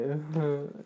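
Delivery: small laugh at own joke; quick little laugh; amused chuckle. Real, not acted. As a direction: let out a short chuckle.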